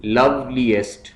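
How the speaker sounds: loveliest slowly in beats, love-li-est; split into syllables. Shown as a demonstration. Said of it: "syllables में divide करना है".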